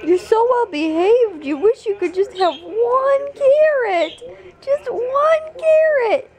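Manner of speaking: high, slow cooing; pitying pet talk. Real, not acted. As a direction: coo sympathy at the poor puppy. Pitying coo at the dog.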